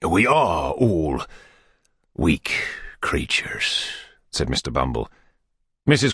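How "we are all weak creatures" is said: drawn out and fading into a whisper; slow whisper. A narrator's reading. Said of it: "Bumble repeats her words".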